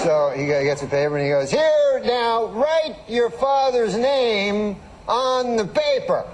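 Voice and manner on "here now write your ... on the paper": high, very slow, stretched Cosby voice; Bill Cosby impression. Cosby instructing the fan, in a high drawn-out voice. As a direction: stretch every word out like Cosby. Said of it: "He has that funny way of talking".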